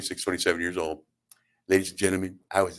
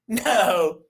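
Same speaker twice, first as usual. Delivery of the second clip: high, drawn-out correction; slow high-pitched small-man impression; little man's voice; 11 semitones higher, much slower than usual. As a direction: squeak it out slowly, word by word. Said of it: "And he goes".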